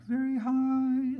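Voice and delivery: squeaky-high, drawn-out demo voice; very high pitch. Shown as a demonstration. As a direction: shoot way up into falsetto and stretch it out. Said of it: "So high refers to".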